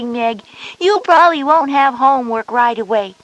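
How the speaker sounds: usual speaking voice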